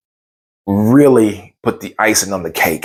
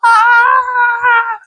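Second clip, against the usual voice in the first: high excited yell; 3 dB louder than usual. Retold or imitated. Celebrating the win.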